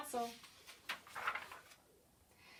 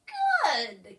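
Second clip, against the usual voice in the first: cackle; 4 semitones higher, 8 dB louder than usual. A narrator's reading. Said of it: "cackled the small creature".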